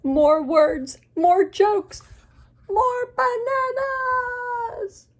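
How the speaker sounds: building storybook wail, last word stretched out; escalating desperate yell; Gerald. Acted. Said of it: "So Gerald is upset".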